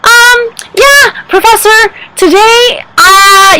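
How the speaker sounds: high-pitched, exaggerated Australian professor; hammed-up Aussie professor impression; mock Australian accent. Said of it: "he always talks like this with a really exciting accent".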